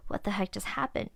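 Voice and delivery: breathy whisper to herself, spooked. Retold or imitated. Spooked after hearing crying from the closet, whispering. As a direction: hush it like you're alone and scared.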